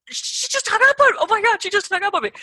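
shrill, loud, stunned disbelief; flabbergasted. Retold or imitated. Stranded driver stunned that the innkeeper hung up, high and loud. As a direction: she really just hung up. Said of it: "I was just flabbergasted".

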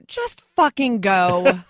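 loud, slow, emphatic mock command; retold blunt order. Manager ordering client to an audition, loud exasperated imitation. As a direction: punch out each word loudly. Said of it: "his exact words were".

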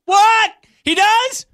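very high, shouted fake astonishment; mock shock. Host feigns shock on hearing his co-host pops up, mock astonishment. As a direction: squeal it in hammed-up disbelief.

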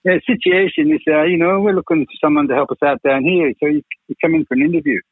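high-pitched, hammed-up Scottish voice; bad Scottish accent. Producer inviting him in for an interview, bad Scottish accent. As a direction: imitate a Scottish boss, pitched well up. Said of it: "doing a bad Scottish accent".

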